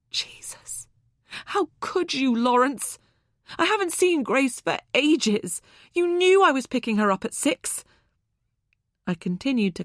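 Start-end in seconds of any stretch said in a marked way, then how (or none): 0.1-0.9 soft unvoiced exasperated breath; whispered oath. A narrator's reading. 1.5-7.8 higher, louder, reproachful; hurt accusation. A narrator's reading. Accusing ex of betrayal.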